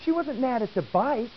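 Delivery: normal talking voice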